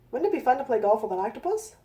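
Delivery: plain reading voice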